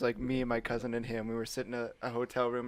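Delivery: normal talking voice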